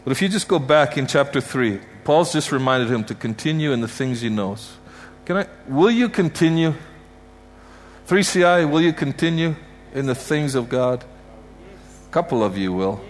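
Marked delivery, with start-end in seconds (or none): 5.2-11.0 higher, louder pleading question; fervent appeal. Real, not acted. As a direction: press them to answer, rising up. Preacher exhorting the congregation.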